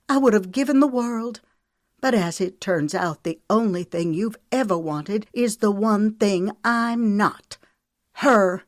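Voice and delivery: high and loud, wounded accusation; bitter outburst. Acted. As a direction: throw the last word at him like a blow. Mary Kate's bitter accusation.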